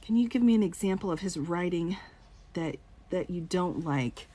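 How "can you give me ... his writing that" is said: ordinary talk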